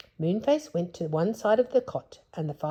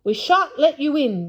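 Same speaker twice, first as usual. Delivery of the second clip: louder and higher defiant yell; 10 semitones higher, 9 dB louder than usual. Acted. Joe yelling at the bears outside the door. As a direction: shout it at the door. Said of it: "yelled Joe".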